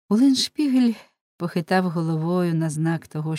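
plain reading voice

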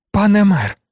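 high, loud cry of alarm; desperate high-pitched appeal. A narrator's reading. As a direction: cry out in distress, pitch leaping up. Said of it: "закричала Фантіна".

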